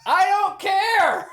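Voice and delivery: mock Tommy Lee Jones, dragged out and called. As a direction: call it out slow like a distant marshal. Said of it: "Tommy Lee Jones is all the way".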